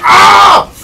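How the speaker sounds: sharp breathless yelp of surprise; sudden scared yelp; startled cry. Real, not acted. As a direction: jump and cry out. Startled by enemy attack.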